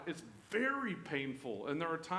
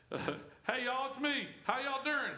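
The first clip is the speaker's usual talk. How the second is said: high, friendly Southern drawl greeting; Southern Jesus; 9 semitones higher, slower than usual. Pretend. Jesus greeting frightened disciples, playful Southern accent. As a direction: call it out like a good-ol' Southern buddy. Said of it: "If he was from the South, right? That's how he talked".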